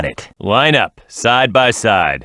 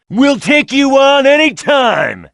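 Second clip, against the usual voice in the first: cocky battle shout; slower than usual. Acted. Hero taunting rivals before a race, high cocky shout. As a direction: yell it at the rival team, full of swagger. Team boasting before a fight.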